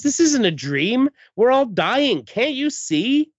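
high-pitched, dramatic pleading; hammed-up panic, stretched at the end; acted desperation. Acted panic, all dying.

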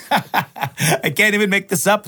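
slow words through real laughter; can't get through it for laughing; real laughter breaking into the words. Real, not acted. Laughs at own story.

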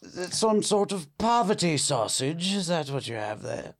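disdainful fancy English query. Acted. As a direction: look down on the food in posh English. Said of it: "Is this fancy English".

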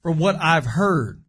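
slow lead-in, leaning on "heard"; stress on heard. Real, not acted. Hedging a rumor about a celebrity couple, stress on "heard". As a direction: put deliberate weight on the word "heard". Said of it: "emphasis on the word heard".

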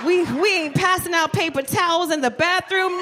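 ordinary delivery

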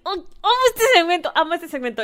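high, loud burst of delight; thrilled and high-pitched; excited gushing. Real, not acted. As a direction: squeal how much you love it. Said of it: "me emociona mucho".

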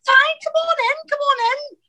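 very high squeaky welcome; over-bright fake cheer. Scared performer calling producers into her dressing room, over-bright fake cheer. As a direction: chirp a frantic, hammed-up welcome. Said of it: "to make everyone else feel everything was gonna be fine".

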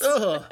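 high loud ugh of disgust; disgusted groan. Real, not acted. Co-host recoiling at gross suggestion, disgusted ugh.

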